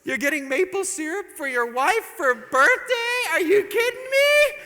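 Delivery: hammed-up furious wife; outraged wife. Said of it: "wife outraged in story".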